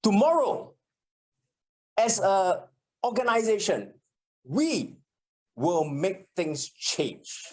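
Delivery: mock political speech, words hammered; emphatic politician. Shown as a demonstration. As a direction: punch each word like a leader at a podium. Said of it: "even politicians do that a lot".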